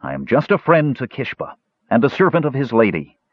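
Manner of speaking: ordinary delivery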